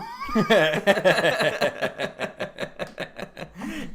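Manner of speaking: a big hearty burst of laughter, climbing high; loud laugh. Real, not acted. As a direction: laugh out loud, really cracking up. Laughing at the self-deprecation bit.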